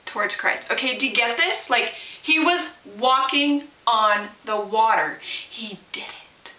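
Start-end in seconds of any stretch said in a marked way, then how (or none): 5.2-6.5 slow hushed whisper; whispered amazement at the miracle; awed whisper. Real, not acted. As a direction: breathe it in wonder. Awed about Peter walking.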